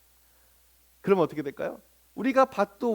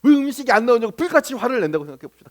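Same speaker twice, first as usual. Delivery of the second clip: ordinary talk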